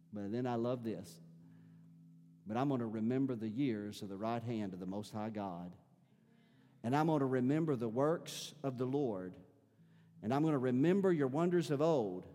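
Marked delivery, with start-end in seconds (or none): 2.4-12.3 soft, much quieter vow to remember; quiet resolve. Acted. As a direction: lower the voice, tender resolve.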